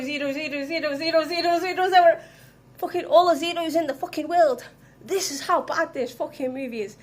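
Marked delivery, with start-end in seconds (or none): 0.0-4.7 louder rant. Real, not acted. Escalating rant about zero score.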